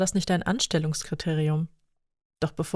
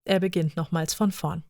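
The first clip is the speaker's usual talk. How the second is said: plain reading voice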